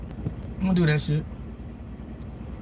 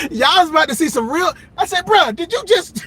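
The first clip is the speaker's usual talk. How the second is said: excited retelling; 9 semitones higher, 4 dB louder than usual. Real, not acted. Reliving car hit.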